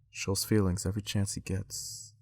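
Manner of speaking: low, hushed spoken-word murmur; Derek Jackson imitation. Quoting his spoken-word video to viewers, soft and low. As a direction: recite it softly like a seductive poet. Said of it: "He would talk just like this".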